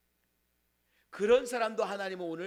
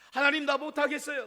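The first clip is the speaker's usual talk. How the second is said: Elijah giving up; 5 semitones higher, 4 dB louder than usual. Acted. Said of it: "떼쓰는".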